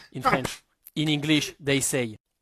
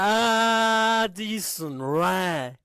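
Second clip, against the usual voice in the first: slow correction; 4 semitones higher, much slower than usual. Real, not acted. Correcting her name slowly.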